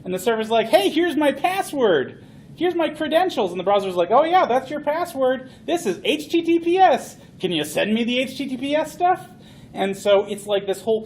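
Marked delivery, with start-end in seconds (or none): 0.8-3.5 high, slowed, eager password handoff; voicing the browser. Acted conversation, handing credentials. 4.2-9.2 high, odd character voice checking the password; voicing the server. Server voice in acted conversation.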